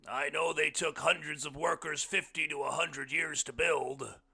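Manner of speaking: loud, high character voice; hearty blind man. A narrator's reading. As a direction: project it big and sure. Blind man's speech in story.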